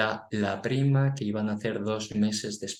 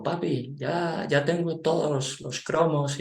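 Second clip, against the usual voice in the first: put-on excited child's voice; 7 semitones higher, slower than usual. Father quotes son.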